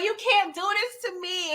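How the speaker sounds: high, tearful imitation of a begging woman; mock crying plea. Retelling the episode to viewers, imitating Diana begging Tariq with a mock cry. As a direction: whimper it like she's about to cry, half-hammed.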